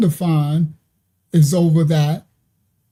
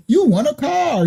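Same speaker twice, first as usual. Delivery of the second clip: mock car salesman; 7 semitones higher than usual. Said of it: "It'd be like".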